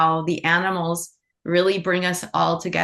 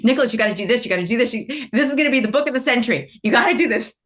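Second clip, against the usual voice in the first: excited, higher and louder pestering; bossy urging; 6 semitones higher, 4 dB louder, much faster than usual. Retold or imitated. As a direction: push them hard, playfully bossy and insistent. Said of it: "that's where my bossiness comes in".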